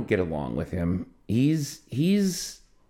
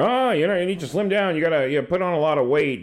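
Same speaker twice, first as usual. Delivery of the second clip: higher-pitched, well-meaning scolding; nagging uncle; 9 semitones higher, 3 dB louder than usual. Retold or imitated. Uncle nagging about weight every summer, mock well-meaning. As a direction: imitate a relative's unsolicited advice, pitched up.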